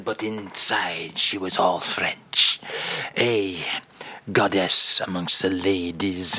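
low, dreamy fake French reminiscing; a fake Frenchman fondly recalling his Paris days; lightly voiced French crooner; mock French accent.

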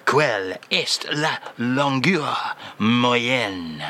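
put-on slow, low French-accented crooner voice; a slow French charmer's voice. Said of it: "Say the French phrase for us".

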